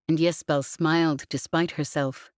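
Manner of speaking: ordinary delivery, nothing marked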